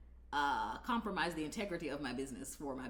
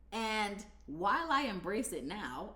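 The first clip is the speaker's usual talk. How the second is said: ordinary talk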